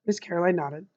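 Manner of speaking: plain reading voice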